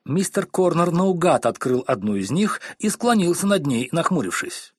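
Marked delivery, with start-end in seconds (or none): none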